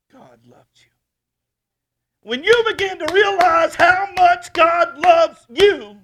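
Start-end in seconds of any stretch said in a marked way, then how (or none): 2.2-6.0 loud, high, slow preacher's cry; preaching at full force. Acted. Pastor crying out to his church, full-force preaching. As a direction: pour it out over the congregation.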